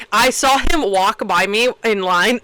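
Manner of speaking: usual speaking voice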